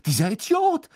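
high, gleeful disbelieving yell. A narrator's reading. Ron mocks Krumm's catch while clapping, high yell. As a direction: keep exclaiming high over the applause. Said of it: "brüllte Ron".

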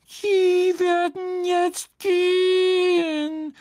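loud, high, drawn-out squeak; slow squeaky outrage; indignant house-elf. A narrator's reading. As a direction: order him off in elf voice. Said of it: "sagte er empört".

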